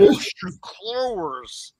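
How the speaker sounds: Worcester Clovers said slow with hard R's stuck in; slow mock of locals adding R's to Worcester Clovers; added R demo. Shown as a demonstration. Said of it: "add R's that don't even".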